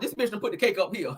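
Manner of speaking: usual speaking voice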